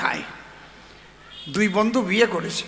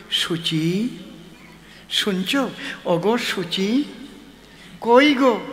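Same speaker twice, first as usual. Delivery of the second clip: tender call; slower than usual. Shown as a demonstration. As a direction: coo her pet name slowly. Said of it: "আমি বলি". (বউকে আদর করে ধীরে নরম গলায় ডাকা)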